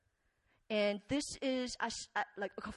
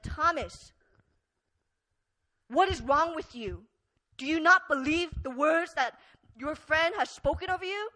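scolding; 4 semitones higher, 6 dB louder than usual. Pretend. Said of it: "Jesus didn't do that".

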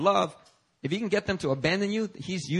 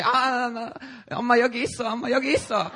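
imitating his mom; 8 semitones higher, much faster than usual. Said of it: "my mom will come out and say".

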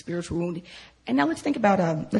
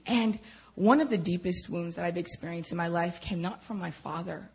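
ordinary delivery

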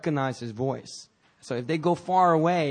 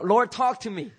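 raised plea, ending in a loud bark; demanding prayer; 7 semitones higher, 7 dB louder, slower than usual. Acted. Impatient believer praying to a silent God, demanding. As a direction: demand an answer from heaven.

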